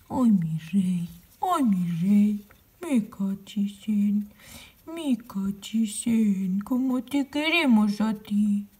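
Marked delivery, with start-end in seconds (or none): 0.1-2.5 cooing. Real, not acted. Cooing to the stuffed monkey.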